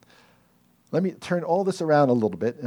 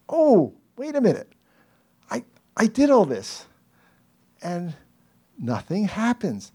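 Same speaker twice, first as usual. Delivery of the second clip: sudden realization; 9 semitones higher than usual. Acted. Preacher acting out his own puzzled surprise, high and startled. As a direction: catch yourself in mock surprise. Acted realization nothing happens.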